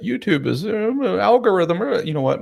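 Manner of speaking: hammed-up grumbling about excuses; mock excuse-making. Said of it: "I could make all kinds of excuses".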